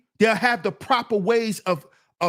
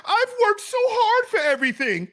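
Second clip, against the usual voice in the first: mock a man whining like his mother; loud, hammed-up aggrieved whine; whining mama-like complaint; 11 semitones higher, 5 dB louder than usual. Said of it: "You sound like your mama".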